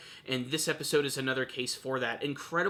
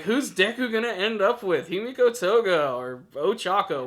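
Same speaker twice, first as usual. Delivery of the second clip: loud, higher, playful shipping question; mock fan debate; 6 semitones higher, 7 dB louder than usual. Reviewer imitating the fanbase's shipping war, mock-excited and loud. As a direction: pitch it up loudly like a worked-up fan.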